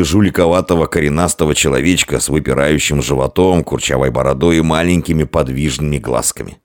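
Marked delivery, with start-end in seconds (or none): none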